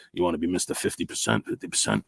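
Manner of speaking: low, hushed TV cop impression; Sorvino. Streamer does a little actor impression, low and hushed. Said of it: "Sorvino, like".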